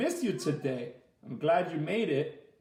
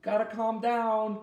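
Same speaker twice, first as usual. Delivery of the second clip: slow, higher mock parent soothing; mom's voice; 5 semitones higher than usual. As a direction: play mom telling you to calm down. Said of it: "my mom's like".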